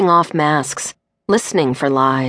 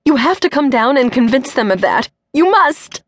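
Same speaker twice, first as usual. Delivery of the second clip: desperate insisting; 8 semitones higher than usual. Acted. Mother insisting Cindy must come, near tears. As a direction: push hard, on the edge of sobbing. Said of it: "Then she started sobbing".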